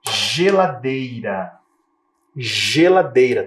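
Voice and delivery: "Geladeira" very slow, G said as J; teacher sounding out "Geladeira" with a J; J sound demo. Shown as a demonstration. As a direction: drag "Geladeira" out, J clear. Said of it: "Também um som de J".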